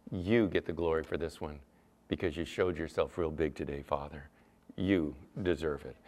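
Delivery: soft, low prayer to God; reverent praise. Acted. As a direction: hand over the credit quietly and humbly.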